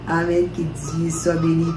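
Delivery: normal talking voice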